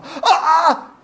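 sudden high wail of dismay; anguished cry. Retold or imitated. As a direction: cry out as if something precious just shattered. Breaking a treasured thing.

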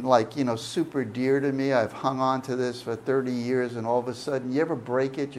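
ordinary talk, nothing marked

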